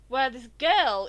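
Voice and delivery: ordinary delivery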